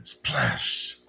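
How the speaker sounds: breathy imitated splash sound; whispered splash. Landing in the basement water, voiceless splash noise.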